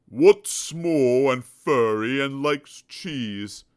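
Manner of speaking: slow, loud, smug elephant voice; a know-it-all elephant teasing; clever elephant. Acted. Elephant quizzing Mister Dizzy, slow and smug.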